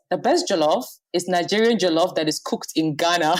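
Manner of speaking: usual speaking voice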